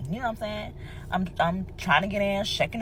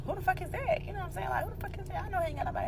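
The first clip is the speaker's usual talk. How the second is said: suspicious disbelief; 13 semitones higher, 9 dB quieter, much faster than usual. Retold or imitated. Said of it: "I'm like".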